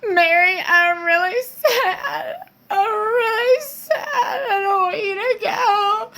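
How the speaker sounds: high, slow fake crying plea; mock tearful wail, slow and high; mock sobbing. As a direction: ham up a weepy goodbye. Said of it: "she's like".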